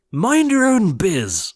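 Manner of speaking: high, loud, slow snap; rude retort. A narrator's reading. Prince snapping at Cindy. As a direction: snap it back rudely. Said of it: "the prince replied".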